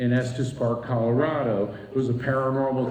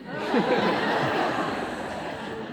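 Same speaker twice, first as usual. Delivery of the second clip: breathless laugh; 9 semitones higher than usual. Real, not acted. Amazed laugh at message.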